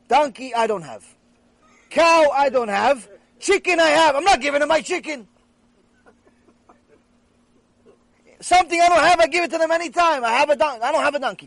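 loud, high-pitched acted protest; playing an exasperated man spelling it out loudly; emphatic self-justification. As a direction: argue your case at rising volume. Acted man heatedly justifies himself to friend.

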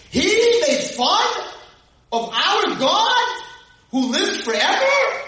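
slow, high, emphatic; indignant declaration. Retold or imitated. David telling Shaul of the blasphemy, slow and outraged. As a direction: lean hard on every word, outraged. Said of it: "Vayomer David el Shaul".

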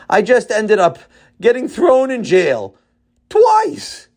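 a grown man choking up as his complaint spills over; crying. A narrator's reading. Tzadok crying to Tati that every segula he invents ends in jail. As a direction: voice breaking into a high cry on the last word.

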